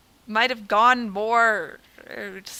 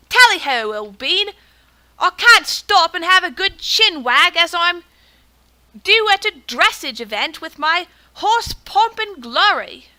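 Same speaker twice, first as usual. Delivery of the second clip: higher, louder, slow would-be British toff; British accent attempt; 4 semitones higher, 5 dB louder, slower than usual. Gamer voicing Sir Victor in a wandering British accent. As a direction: ham up a posh British horseman, drifting off-accent. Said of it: "I was going for British".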